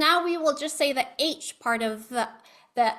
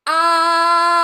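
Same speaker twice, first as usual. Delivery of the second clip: long loud open ah held five seconds; steady voiced ah for a vocal exercise; sustained ah; 5 semitones higher, 12 dB louder than usual. Shown as a demonstration. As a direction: hold a strong voiced ah. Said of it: "we'll just do a five second ah".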